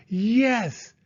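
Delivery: high, loud cheer; excited yes. Acted. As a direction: cheer in triumph. Boy hears it's a brother.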